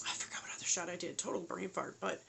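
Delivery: quick whisper under her breath; whispered aside. Real, not acted. Mom vlogging to viewers, a quick whispered aside. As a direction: drop to a fast whisper for the aside.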